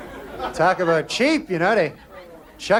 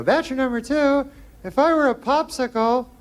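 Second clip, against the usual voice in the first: put-on higher, sing-song dating show girl reading the card; a hint of a coy contestant; mock girl voice; 8 semitones higher than usual. Girl reads dating question.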